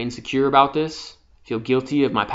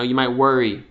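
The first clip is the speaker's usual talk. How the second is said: ordinary talk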